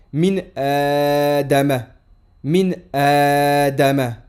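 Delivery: Min Adama, very slow, the a of Adama drawn out; drawn-out model of Min Adama; slow model reading. Shown as a demonstration. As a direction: spell Min Adama out slowly for the class. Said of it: "hamzatul wasl accompagné d'un alif prolongé".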